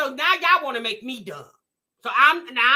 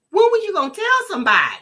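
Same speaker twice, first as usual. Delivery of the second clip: indignant repeat; 4 semitones higher, 7 dB louder, much faster than usual. Real, not acted.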